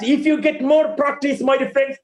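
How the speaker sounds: ordinary delivery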